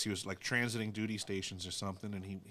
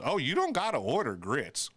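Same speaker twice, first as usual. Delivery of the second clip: sassy Southern waitress correcting a Yankee, louder; 6 semitones higher, 7 dB louder than usual. Retold or imitated. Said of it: "she goes".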